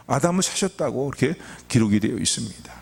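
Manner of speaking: normal talking voice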